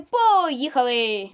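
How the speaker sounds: long drawn-out kickoff call; slow drawn-out start. Real, not acted. Youtuber drawling to viewers as the game starts, slow and stretched. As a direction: stretch the word out slowly, announcing the start.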